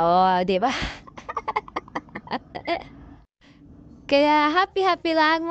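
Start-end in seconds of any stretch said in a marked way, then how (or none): 1.2-2.8 airy laugh. Real, not acted. Laughing at friend's pose.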